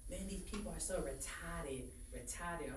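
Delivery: a lightly imitated, overdone Boston accent, slow; mock a partygoer pushing his Boston accent out hard; pushed Boston accent. Retelling the Boston guy at a party, heavy Boston accent. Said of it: "you're really pushing your accent out".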